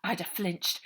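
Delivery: usual speaking voice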